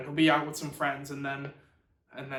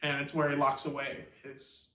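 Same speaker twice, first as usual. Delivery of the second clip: normal talking voice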